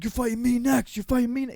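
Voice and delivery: loud, high-pitched taunting yell; shouted threat. Retold or imitated. Fighter yelling at his rival mid-brawl, loud shouted threat.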